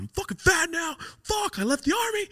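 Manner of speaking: very high-pitched mock griping; exaggerated retold complaint; complaining friend.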